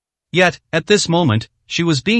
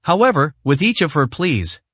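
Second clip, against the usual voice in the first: plain reading voice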